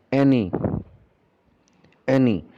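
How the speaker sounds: 'any' said clearly, twice; model pronunciation. Shown as a demonstration. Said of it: "I will be pronouncing each and every word".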